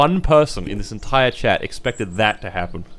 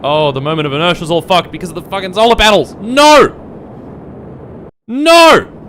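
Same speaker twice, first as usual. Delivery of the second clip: fast, loud complaint rising to high screamed no; real dismay building into a shout; distraught outburst; 8 semitones higher, 9 dB louder than usual. Real, not acted. Streamer watching the rocket fail, distraught outburst. Launch goes wrong.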